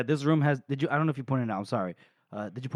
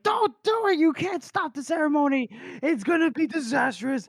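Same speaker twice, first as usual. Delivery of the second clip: much higher, frantic warning; mock panic; 12 semitones higher than usual. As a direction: cry out in hammed-up alarm. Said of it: "everyone's like".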